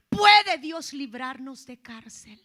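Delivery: slow loud booming question. Real, not acted. Preacher challenging the congregation, loud and slow. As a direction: thunder it slowly.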